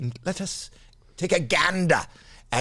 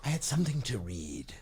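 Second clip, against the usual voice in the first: soft whisper, nearly unvoiced; whispered aside; 3 semitones lower, 8 dB quieter than usual. Voicing a wish from the loo in a whisper. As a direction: breathe it like a secret wish. Acting a toilet thought.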